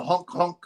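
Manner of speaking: quick clown honks; silly clown. Pretend. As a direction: honk like a clown. Clown honk mockery.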